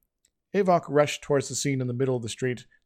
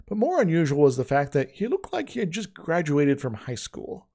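ordinary delivery

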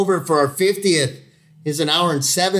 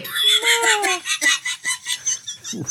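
high laughter. Real, not acted. Laughing at Michigan joke.

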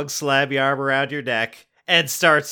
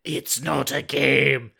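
slow, hollow robot-like alien; hollow mechanical voice; 3 semitones higher, slower than usual. Acted. Alien tells the kids in a hollow mechanical voice. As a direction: intone it like a machine. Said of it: "in a hollow, mechanical voice".

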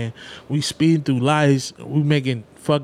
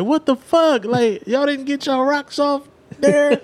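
high, disbelieving outburst; can't believe they want more; mock outrage at his friends; incredulous; 11 semitones higher than usual.

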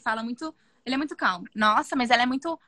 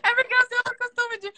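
laughing; 11 semitones higher than usual. Real, not acted. Laughs about nickname.

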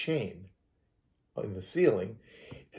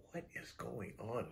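much softer, near-whisper question; hushed alarm; 18 dB quieter than usual. Retold or imitated. As Terry squeezes her hand, hushed uneasy question. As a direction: barely breathe it out uneasily.

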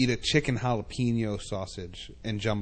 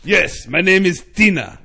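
put-on Arabic accent; 7 semitones higher, 10 dB louder than usual. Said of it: "a thick Arabic accent".

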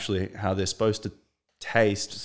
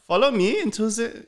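put-on very high storybook boy; piping fairy-tale child; Hansel voice; 13 semitones higher than usual. Shown as a demonstration. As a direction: lead the way in a high boyish voice. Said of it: "What about Hansel?".

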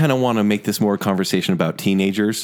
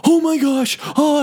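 higher, overdone horror gasp; imitating a dramatic kid in the cinema; mock dramatic scream. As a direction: ham up a scared kid. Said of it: "he was screaming really dramatic".

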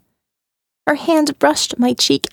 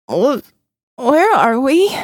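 slow, halting, still half sedated; drowsy stammer; 2 semitones higher, slower than usual. A narrator's reading. Sedated Jill struggling to ask Molly where they are, halting. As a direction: push the words out through the fog of the drugs.

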